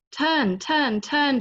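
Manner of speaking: high, loud repeated call of turn; teacher's command. Retold or imitated. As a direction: bark it like a swim teacher. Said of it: "she says".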